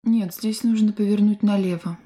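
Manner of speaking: ordinary talk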